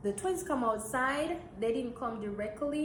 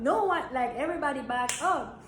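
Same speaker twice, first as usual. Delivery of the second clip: normal talking voice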